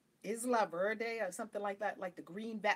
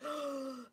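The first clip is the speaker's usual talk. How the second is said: high, re-enacted intake of breath; spellbound gasp; 5 semitones higher, 4 dB quieter than usual. Caught up in the spirit, awed gasp. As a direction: gasp as if seized by wonder.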